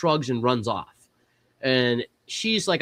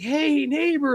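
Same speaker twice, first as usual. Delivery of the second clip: much higher, cheery mock neighbor greeting; neighbor's greeting; 13 semitones higher, slower than usual. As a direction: chirp hello like the friendly neighbor. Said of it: "He's like".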